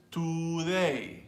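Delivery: today as a drawn-out "too-DAY", full oo vowel; slow, overdone "tooo-day" with a pure oo; over-Hispanicized pronunciation. Shown as a demonstration. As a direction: exaggerate a Spanish-style "too" in today. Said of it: "just to over Spanish, his Spanish decides that".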